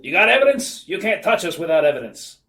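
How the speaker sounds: loud, defiant thug; hammed-up snarling tough guy; Sid. A narrator's reading. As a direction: challenge the police like a cornered crook. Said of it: "Sid snarled".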